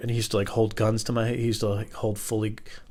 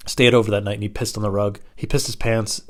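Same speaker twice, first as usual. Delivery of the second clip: normal talking voice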